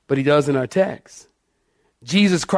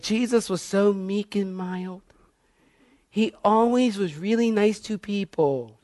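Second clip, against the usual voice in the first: soft, higher mock-sweet voice; mock-pious believer; 4 semitones higher, 6 dB quieter than usual. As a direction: imitate naive churchgoers, gently and sweetly. Mocking the Sunday school view.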